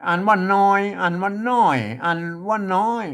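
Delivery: "นอย, น้อย, น้อย", each in a different tone; three tone readings. Shown as a demonstration. Teacher showing a word reads three ways, changing the tone of น้อย. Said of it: "อ่านได้สามเสียง".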